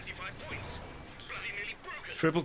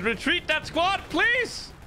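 high pleading cry; 8 semitones higher, slower than usual. Real, not acted. Pleading loudly to unit.

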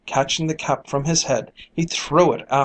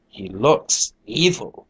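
low, slow sinister voice; menacing accusation; 3 semitones lower, slower than usual. A narrator's reading. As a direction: drag it out darkly. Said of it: "cried a third".

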